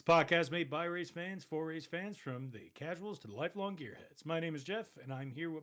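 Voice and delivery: loud, high show-opening welcome; announcer voice. Acted. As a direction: belt out the podcast intro like a host. Opens the podcast as host.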